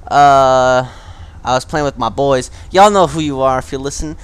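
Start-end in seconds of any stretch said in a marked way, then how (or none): 2.7-4.2 loud shout-out. Real, not acted. Podcaster calling out gaming buddies, very loudly. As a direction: belt out a shout-out to friends. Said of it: "This is probably maxing out really bad".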